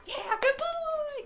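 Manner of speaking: slow, very high squealed praise; excited praise. Real, not acted. Praising dog.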